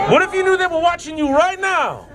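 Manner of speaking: high, projected speech over chanting; rally call. Real, not acted. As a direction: call out over a singing crowd. Calls out over the crowd's chant.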